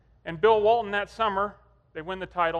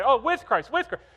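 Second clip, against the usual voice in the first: put-on higher, louder, boys catching their mistake; eager self-correction; 6 semitones higher, 6 dB louder than usual. Sons answering dad's correction, eager and high. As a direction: imitate kids blurting out the fix.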